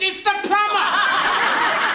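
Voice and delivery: high, loud, drawn-out parrot cry; parrot mimicking. Parrot inside calls to Kathleen on her return, loud mimicking cry. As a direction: screech it slowly like a parrot copying a caller. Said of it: "inside the parrot says".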